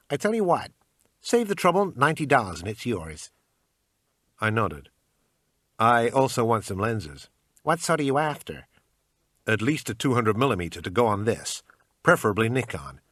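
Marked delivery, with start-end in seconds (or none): 0.1-3.3 pawn shop salesman. Acted. Shopkeeper haggling. 5.8-7.3 bad American accent. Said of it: "in my usual bad American accent". 7.6-8.7 pawn shop salesman. Acted. Shopkeeper haggling. 9.5-13.0 bad American accent. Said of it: "in my usual bad American accent".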